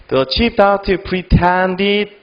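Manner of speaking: very slow, chunk by chunk, reading aloud; slow demo reading. Shown as a demonstration. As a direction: read the English sentence slowly for the class to follow. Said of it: "잘 보세요". (끊어 읽기 시범으로 아주 천천히)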